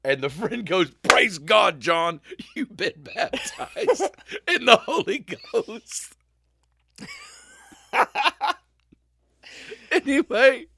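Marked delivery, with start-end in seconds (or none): 1.0-6.1 much louder and higher, laughing through it; ecstatic exclamation. Retold or imitated. The friend calling to John after being thrown, laughing shout. 7.0-10.7 high, loud laugh, then a giggled anyway. Real, not acted. Host recovering from his own story, laughing.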